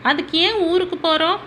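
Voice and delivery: put-on high, quick child's question; curious child. Young son asking his father why they must leave, curious child. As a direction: lift the voice like a puzzled little boy.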